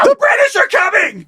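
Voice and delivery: high hammed-up alarm shout; mock Paul Revere cry. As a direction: call out the alarm like a rider at night.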